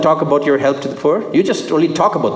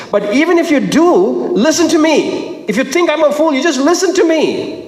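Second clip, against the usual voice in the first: high-pitched, urgent appeal as Paul; insistent plea; 10 semitones higher than usual. Acted. Preacher voicing Paul to the congregation, insistent plea. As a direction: plead to be heard, pitched well up. Acting Paul pleading to be heard.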